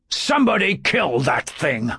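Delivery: disgusted, dismissive command; with distaste. A narrator's reading. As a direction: say it like the snake repels you. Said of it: "with distaste in his voice".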